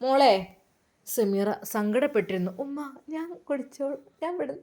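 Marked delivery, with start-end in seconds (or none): 2.6-4.5 put-on shy young woman. Sameera answering mother-in-law softly.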